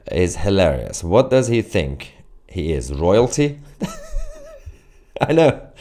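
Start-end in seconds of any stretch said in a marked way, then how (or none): none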